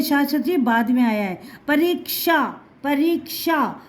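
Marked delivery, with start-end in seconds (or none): 1.7-3.8 परीक्षा with k closed before sha; teacher carefully models the k inside परीक्षा; half-k in परीक्षा. Shown as a demonstration. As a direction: sound out परीक्षा as "pa-reek-sha". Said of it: "आधा का आया है".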